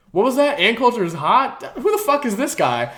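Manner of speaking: high, loud disbelieving outburst; incredulous showrunner. Retold or imitated. Showrunner reacting to the dad's heckle, high incredulous outburst. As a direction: act baffled and outraged at the heckler. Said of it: "the showrunner guy was like".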